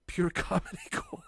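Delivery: high squeaky laugh through the words; laughing too hard to get it out; cracking up mid-phrase. Real, not acted. Laughing recalling funny night.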